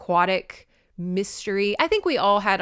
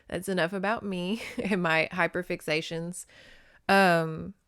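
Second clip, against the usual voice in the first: ordinary talk, nothing marked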